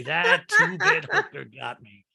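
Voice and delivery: slow, drawn-out comic grievance; mock outrage. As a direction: pretend to seethe at being conned. Invented joke line for robbed Edward.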